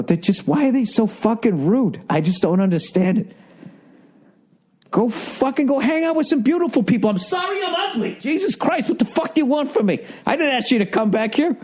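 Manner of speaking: high, strained exasperated rant, voice cracking; retold rant. Retelling to Nia, a hammed-up high angry rant. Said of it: "I go".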